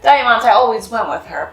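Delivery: ordinary delivery, nothing marked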